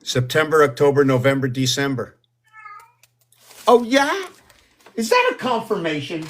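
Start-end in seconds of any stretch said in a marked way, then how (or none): 3.7-6.2 pitched way up, bright surprised; surprised squeak at the cat; high-pitched surprise. Real, not acted. Talking to the cat, a high surprised squeak.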